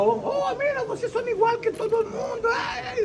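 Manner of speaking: very high shrill mock wail; mocking accuser. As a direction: wail it like a scandalized gossip. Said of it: "un ministro entró con una mujer". (chismosa escandalizada lamentándose con voz muy aguda)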